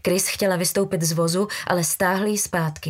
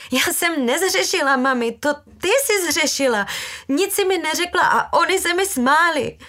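high, loud outburst; anguished accusation; 9 semitones higher, 5 dB louder than usual. A narrator's reading. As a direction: throw the blame back, crying out. Daughter accuses her mother back.

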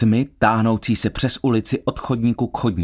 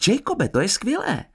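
high, delighted exclamation; Emma; 10 semitones higher than usual. A narrator's reading. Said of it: "zvolala Emma". (nadšené zvolání)

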